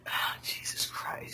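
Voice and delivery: slow breathy whispered aside; muttered exasperated whisper. Real, not acted. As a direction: breathe it out under your breath.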